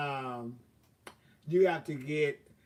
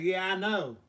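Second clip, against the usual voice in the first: excited; 5 semitones higher, 7 dB louder than usual. Retold or imitated. Bragging about warm weather.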